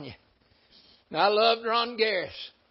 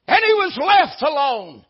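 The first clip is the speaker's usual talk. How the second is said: proclaimed scripture; 7 semitones higher, 10 dB louder than usual. Acted. Scripture quote, preached aloud.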